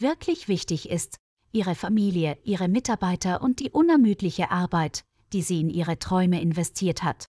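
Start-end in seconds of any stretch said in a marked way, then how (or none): none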